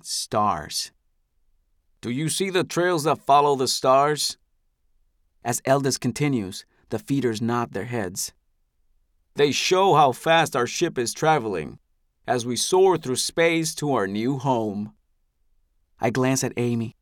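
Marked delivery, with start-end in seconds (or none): 2.0-4.4 grand, higher orator's voice; a patriarch charming his people; benevolent elder. Acted. Eldest addresses the crowd. 9.4-14.9 a patriarch charming his people; benevolent elder. Acted. Eldest addresses the crowd.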